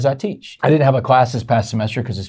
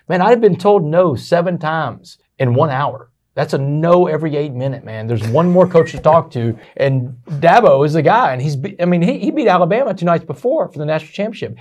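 fired up; 5 semitones higher, 4 dB louder than usual. Real, not acted. Excited retelling of waiting for Dabo.

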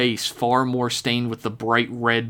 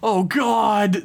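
high, anguished cry; desperate ranting; 8 semitones higher than usual. Acted. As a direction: rave about the lost family, near breaking. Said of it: "the man screamed and ranted".

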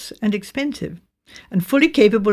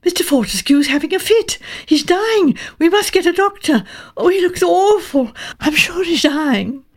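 frightened crying out; 8 semitones higher than usual. A narrator's reading. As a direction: call out in terror for a doctor. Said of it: "crying out".

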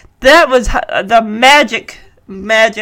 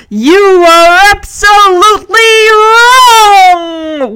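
high, loud, hugely drawn-out game-show holler; booming announcer; 9 semitones higher, 6 dB louder, much slower than usual. Acted. Radio announcer gives the verdict, gleefully loud. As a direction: stretch every word out to a long shout.

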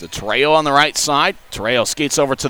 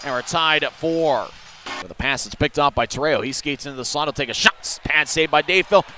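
normal talking voice

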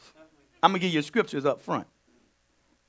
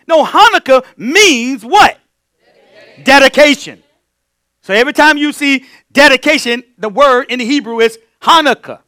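preaching; 7 semitones higher, 10 dB louder than usual. Real, not acted. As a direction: drive the meaning home from the pulpit. Preaching at full force.

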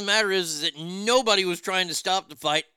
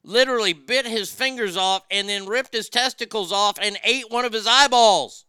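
rising louder and higher; building outrage; 6 semitones higher, 6 dB louder than usual. Real, not acted. Comic tells audience the gory chimp attack, building louder. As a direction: pile it on as the gore escalates.

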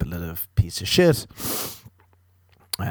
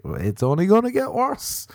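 friend's reply; 12 semitones higher than usual. Retold or imitated. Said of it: "And he's like".

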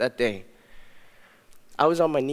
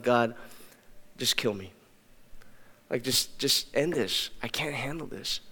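a drained whisper-like plea to God; despairing plea; 4 semitones lower, 10 dB quieter than usual. Retold or imitated. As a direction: beg with nothing left. Despairing prayer on bathroom floor.

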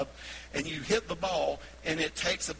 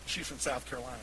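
usual speaking voice

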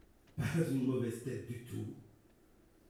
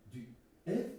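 halting question jumping up in pitch; incredulous echo; 3 semitones higher than usual. Acted. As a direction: repeat it in disbelief, pausing before the name. Echoes the news in disbelief.

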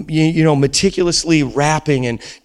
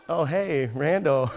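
ordinary delivery

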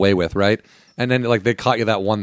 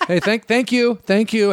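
high, teasing thank you; mock gloating thanks; 10 semitones higher, slower than usual. Dad and his buddy drinking his beer, teasing thanks. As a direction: rub it in with cheeky thanks.

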